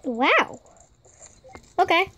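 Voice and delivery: ordinary talk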